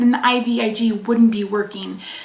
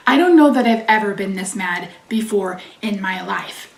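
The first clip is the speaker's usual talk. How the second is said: ordinary talk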